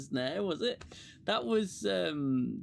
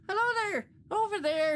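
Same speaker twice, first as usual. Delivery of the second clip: mock Irish accent; 13 semitones higher, 3 dB louder than usual. Mocking Irish colonists from TNG.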